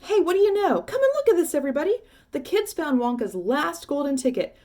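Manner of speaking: high, loud, thrilled shouts; excited shouting. Acted. Shopkeeper to the crowd, shouting with wild excitement. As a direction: call everyone over in wild excitement.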